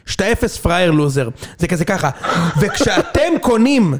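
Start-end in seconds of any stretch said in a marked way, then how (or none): 2.6-3.9 slow, heavily stretched reporter delivery; mock economic TV report. Parodying TV economic reports for Itzik, mock-dramatic reporter. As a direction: spell it out slowly like a consumer exposé. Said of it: "תמיד יש כתבה כלכלית".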